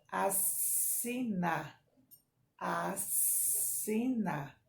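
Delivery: "assina" very slow, the ss a long hiss. Shown as a demonstration. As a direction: draw out the ss in "assina" like a snake. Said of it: "Todas têm um sonzinho da cobra".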